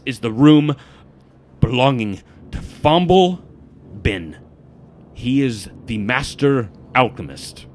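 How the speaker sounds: loud, emphatic, weighty naming; intense guild informant. Acted. Names the alchemist to the party, intense informant. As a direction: stab each key word out.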